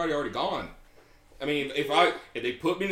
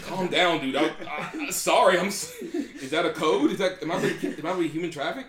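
mock alarm; 6 semitones higher, 5 dB louder than usual. Acting confused target of code phrase.

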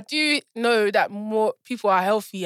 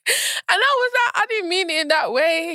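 laughing; 7 semitones higher than usual. Real, not acted. Laughing while retelling.

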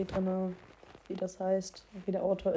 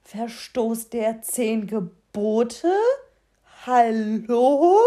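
empört; 9 dB louder, much slower than usual. Real, not acted. Empörte Frage.

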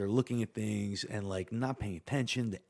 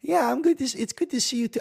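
squeaky high greeting; 5 dB louder than usual. Acted. Awkward squeaky greeting to therapist.

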